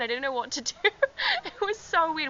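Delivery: real giggles breaking into the words; high, helpless laughter while recounting; laughing. Real, not acted. Giggling over the screaming match.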